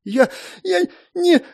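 high, slow shaky mumble; trembling mumble. A narrator's reading. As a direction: stammer in terror. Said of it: "мямлила девочка дрожащим голосом".

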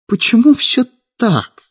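high, drawn-out plaintive question. A narrator's reading. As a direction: wail it lightly, as a complaint. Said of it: "жалобно спросила Светлана".

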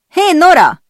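high, loud call across to her. A narrator's reading. Beatrice calling over to Nora, loud and bright. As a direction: shout her name to get her attention. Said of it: "szólt oda nekem".

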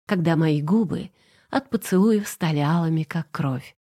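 plain reading voice

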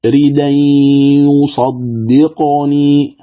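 "ريدين يصدقني" drawn out slowly on a level chant; Warsh recitation demo. Shown as a demonstration. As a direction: hold a long nasal merge into the ya of "ريدين يصدقني". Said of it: "هكذا تقرأ".

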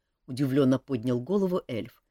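usual speaking voice

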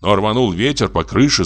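usual speaking voice

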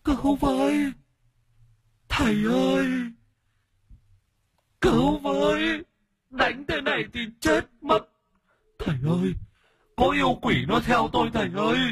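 very high, wailing cries for help; possessed woman's shrieking plea; screaming and crying. Acted. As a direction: howl in tearful panic. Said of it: "rồi gào khóc".

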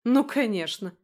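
put-on girl scoffing at the man. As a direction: scoff at him sarcastically. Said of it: "хмыкнула".

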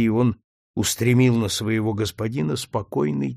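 usual speaking voice

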